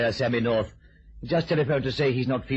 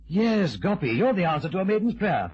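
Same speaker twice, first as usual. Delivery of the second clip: delighted; 8 semitones higher, 3 dB louder than usual. Acted. Delighted stage manager.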